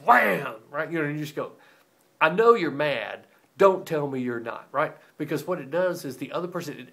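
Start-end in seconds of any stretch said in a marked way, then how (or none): 0.0-0.6 loud, higher shouted slam noise; pot-slam wham. Retold or imitated. Pot slammed on stove. 2.2-4.7 exasperated confrontation. Retold or imitated. Confronting a passive-aggressive spouse.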